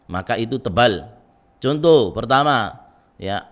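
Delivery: ordinary talk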